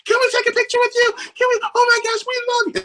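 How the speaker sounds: imitated gushing fans, very high and fast; starstruck kids. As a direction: squeal like excited young fans. Kids crying, meeting their idol.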